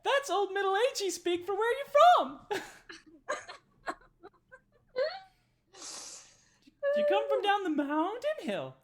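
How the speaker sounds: very high, loud, squeaky hostess voice; squeaky, loud Darnsky serving the party; eager barmaid. Acted. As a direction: push the drink on them with bubbly certainty. Darnsky explains herself.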